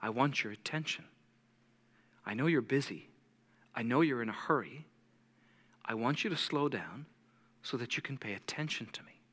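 soft, low and unhurried, with pauses; gentle fatherly voice. Acted. Preacher voicing the Father to busy followers, softly and gently. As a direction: speak tenderly as a patient father. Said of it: "Your father today is saying".

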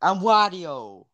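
high, stretched mock-Jersey holler; Wario, New Jersey accent. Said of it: "couple Warios like that walking around".

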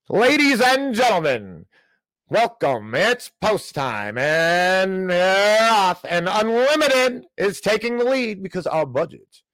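high, slow, drawn-out track announcer call; horse race announcer. Pretend. Host mocking the budget as a horse race, announcer voice. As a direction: call it like the horses just left the gate. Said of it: "Maybe I'll talk like".

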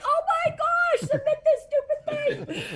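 a wife squealing at the last-minute submission, half laughing; freaking out. Retold or imitated. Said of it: "I was freaking out".

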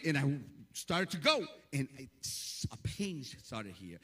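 normal talking voice

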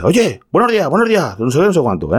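high, mocking imitation of an impatient customer; mocking rude customer. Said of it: "ese hombre dice". (imitando con burla a un cliente impaciente, mucho más agudo)

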